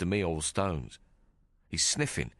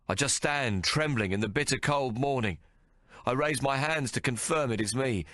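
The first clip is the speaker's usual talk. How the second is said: ordinary delivery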